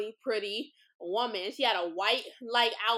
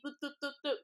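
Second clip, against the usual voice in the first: soft, higher little boops; playful boops; 4 semitones higher, 7 dB quieter, much faster than usual. Real, not acted. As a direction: toss out quiet playful beeps. Playful sound after a shout-out.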